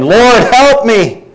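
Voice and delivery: high, slow, drawn-out plea; pleading mother. Acted. Mother begging Jesus to save her daughter, high and slow. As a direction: cry out for help, desperate.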